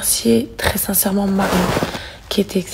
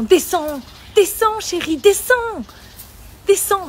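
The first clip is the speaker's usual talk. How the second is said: higher coaxing call to a cat; coaxing a pet; 9 semitones higher, slower than usual. Real, not acted. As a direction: urge the cat down, voice raised.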